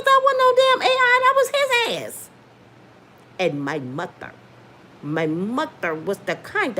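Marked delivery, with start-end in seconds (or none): 0.0-2.3 pitched high with indignant emphasis; indignant, raised voice; heated insistence. Real, not acted. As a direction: insist hotly that it was really him.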